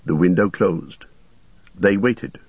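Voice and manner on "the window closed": ordinary delivery, nothing marked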